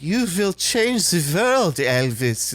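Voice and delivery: slow, high mock German accent; a hammy German accent impression. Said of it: "speaks in a German accent".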